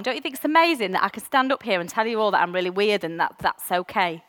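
ordinary talk

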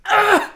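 high, loud laughing pained grunt; mock groan of effort. Son mimics dad standing with gout, high pained groan. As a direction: strain out an old man's groan. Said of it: "And he goes".

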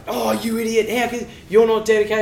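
high-pitched, hammed-up berating; mock scolding. Imitating people who pile on a struggling athlete, high mock scolding. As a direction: imitate someone piling on, pitched well up. Acted quote of people piling on.

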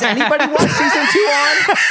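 laughing. Real, not acted. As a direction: laugh it out in disbelief. Laughing disbelief.

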